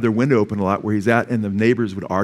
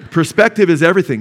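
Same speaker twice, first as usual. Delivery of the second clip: laughing it out, a touch louder; 5 semitones higher, 5 dB louder than usual. Real, not acted. Preacher laughing to the congregation after a joke. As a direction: chuckle through the words.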